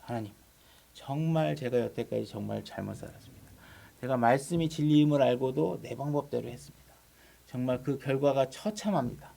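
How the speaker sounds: soft, low confession; acted repentance, hushed and earnest; penitent prayer. Preacher voices a sinner repenting to God, soft penitent prayer. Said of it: "이제 회개를 하게 됩니다".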